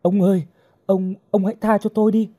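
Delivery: high, frightened begging; pleading. A narrator's reading. Captive begging the lecher to spare her, pleading. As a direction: beg for mercy with stumbling repeats. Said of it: "Hồng van nài".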